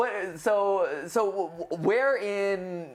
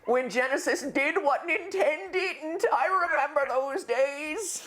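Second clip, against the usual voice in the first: hammed-up old-timer, high and slow; old man; 7 semitones higher, slower than usual. Host recalling Genesis days, high mock old-man voice. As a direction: draw it out like a reminiscing codger. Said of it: "I'm an old man".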